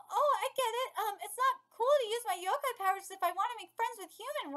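ordinary delivery

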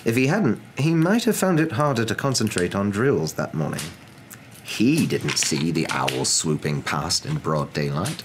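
ordinary delivery

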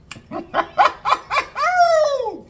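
squealing high giggle; high laugh. Real, not acted. Laughing after food.

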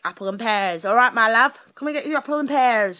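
loud East End fruit-seller's call; East End accent. Acted. Imitating someone selling fruit and veg, loud East End accent. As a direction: hawk it like a London market trader. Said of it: "it's like selling fruit and veg".

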